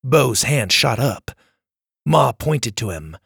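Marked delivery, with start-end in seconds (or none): none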